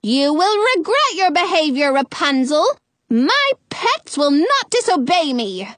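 high, sputtering threat; irate, high-pitched witch's tirade; hollering. A narrator's reading. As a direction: sputter with outrage, then lay down the law. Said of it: "Frau Gothel hollers".

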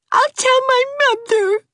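put-on high, tearful girl's wail; high crying threat; weeping. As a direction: wail it through tears. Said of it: "wept Ivy".

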